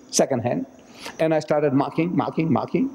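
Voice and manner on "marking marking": ordinary talk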